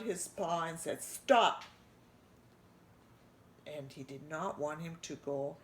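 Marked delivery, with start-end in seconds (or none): 1.0-1.6 loud sudden shout of warning; sharp stop. A narrator's reading. Lucas stops his cousin grabbing the food, loudly. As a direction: stop him cold.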